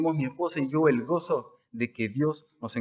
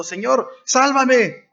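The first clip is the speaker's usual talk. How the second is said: very high, slow, stretched plea for rescue; drawn-out pleading cry; 10 semitones higher, 6 dB louder, much slower than usual. A narrator's reading. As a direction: wail it slowly, begging to be saved. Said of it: "dio voces diciendo".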